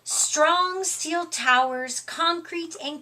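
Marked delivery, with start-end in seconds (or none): none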